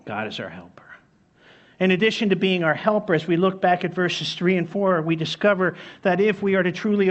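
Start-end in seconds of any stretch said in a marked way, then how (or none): 0.0-0.8 breathy unvoiced whisper, much softer. Shown as a demonstration. Preacher whispers the truth to his church. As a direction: let the repeat sink in as a whisper. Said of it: "said aloud first".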